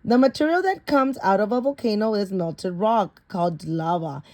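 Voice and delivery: usual speaking voice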